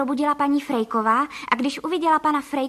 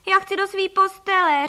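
put-on high, loud childish whine; whimpering; 7 semitones higher, 6 dB louder than usual. A narrator's reading. Boy wanting his own bed, whimpering. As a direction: wail it like a homesick little boy. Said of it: "I chlapci začali pofňukávat".

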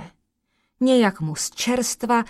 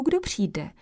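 plain reading voice

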